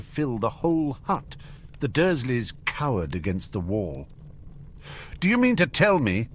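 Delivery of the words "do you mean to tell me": high, heated demand; indignant growled question; angry growl. A narrator's reading. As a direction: confront them in outrage. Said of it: "he growled at the Dursleys".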